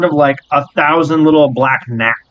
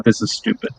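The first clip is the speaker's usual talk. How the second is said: unimpressed companion; 4 semitones lower, 6 dB quieter than usual. Acted. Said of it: "Hank is just hovering next to you like".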